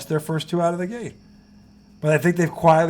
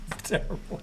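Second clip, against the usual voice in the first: breathless laughing; 5 semitones higher, 7 dB quieter than usual. Real, not acted.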